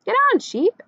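high, loud urging; shout. A narrator's reading. Everyone urging Sheep onto the tractor, shouting. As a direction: call out sharply to a straggler. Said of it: "everyone shouted".

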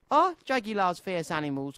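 ordinary delivery, nothing marked

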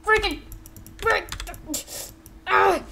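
high-pitched wounded outburst; strained, high acted fury; pained cursing. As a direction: curse in short high bursts through play-acted pain. Wounded character curses and threatens back.

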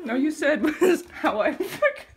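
loud, slow, laughing through it. Real, not acted. Actor breaking up on set, laughing. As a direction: giggle out the words.

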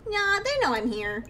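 put-on high, bouncy little kid teasing the player. Ghost kid teasing the startled player, high little kid voice. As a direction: play a cheeky ghost kid pretending to be normal. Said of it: "I'll just give it a little kid voice".

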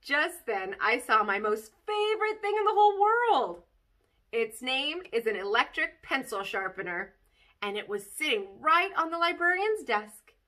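put-on lively read-aloud of a kid's chapter book; bouncy child-narrator storytelling; story reading. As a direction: tell it like a bedtime story to children. Reads Junie B. story aloud.

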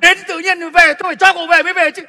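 loud, high, fast scolding; angry command. Acted. Retired boss angrily forbidding the young man to leave. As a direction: lay down the law as the boss.

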